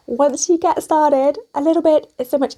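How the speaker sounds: lightly voiced hamster impression; a hint of a cute little hamster. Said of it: "I'm doing a hamster impression".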